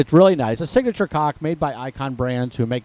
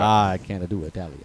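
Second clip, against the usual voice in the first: put-on Italian accent; 7 semitones lower, 3 dB quieter than usual. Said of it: "say it in your best Italian accent".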